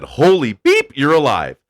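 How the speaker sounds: high, loud startled cry; a soldier's loud shocked yell; shocked shout. Retold or imitated. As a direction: gasp it out like you found him alive. Soldier finds him alive in wreck.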